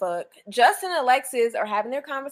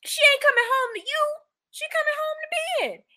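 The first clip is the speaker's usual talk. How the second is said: mock high voice. Mocking the crying friend.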